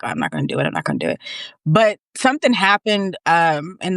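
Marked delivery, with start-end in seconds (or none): 0.0-1.2 mock scared self. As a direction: mumble it low like the scared past self backing out.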